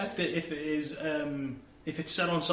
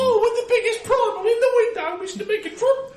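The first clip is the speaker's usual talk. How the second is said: high, loud, squeaky Cockney urchin; small Cockney child; 10 dB louder than usual. Acted. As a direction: pipe up as an eager little Cockney kid. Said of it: "him to be a small Cockney child".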